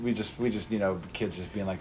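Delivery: ordinary delivery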